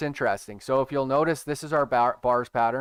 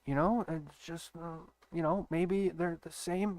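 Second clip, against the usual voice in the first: much softer, higher secretive murmur; conspiratorial hush; 4 semitones higher, 8 dB quieter than usual. Acted. Telling viewers a secret, in a soft hush. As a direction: let them in on a secret.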